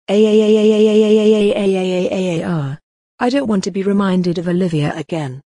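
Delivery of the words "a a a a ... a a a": loud wail, fading out; distressed wailing. Acted. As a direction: wail in horror. Scream of horror.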